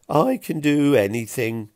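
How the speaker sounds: loud, high, beaming repeat; soppy grin. A narrator's reading. Pilk echoing Fiona's words, loud and beaming. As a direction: grin through the words, dreamy and proud. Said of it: "Pilk repeated Fiona's words with a soppy grin".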